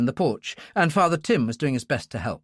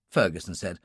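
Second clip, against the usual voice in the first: usual speaking voice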